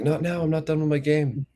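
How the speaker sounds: high-pitched mock kid whine; whiny protest. Gamer son to parents pulling him away, high whiny protest. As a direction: imitate a kid whining to be left alone.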